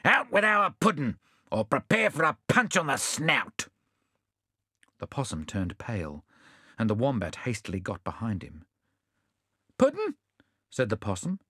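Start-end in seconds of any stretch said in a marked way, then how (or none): 0.0-3.7 threatening. Acted. Bill threatens the possum. 9.8-10.2 high, squeaky feigned surprise; mock amazement. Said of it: "acting amazement".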